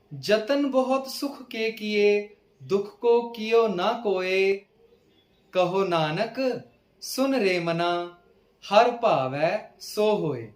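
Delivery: slow, measured verse reading; scripture recitation. Acted. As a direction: recite each line slowly, stretching the words.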